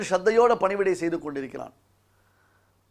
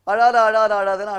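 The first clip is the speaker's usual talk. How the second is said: raised, louder repeated order, acted; loud scolding command; 3 semitones higher, 6 dB louder than usual. Indra strikes and orders the embryo not to cry, loud scolding. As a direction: scold forcefully, as if over each blow.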